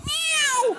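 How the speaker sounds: high mock cat meow. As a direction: squeal it like a cat. Cat sound in joke.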